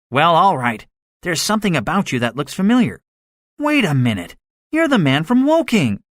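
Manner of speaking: animated gunner, pitch jumping up in recognition; gunner, recognizing someone. A narrator's reading.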